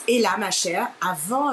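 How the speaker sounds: ordinary talk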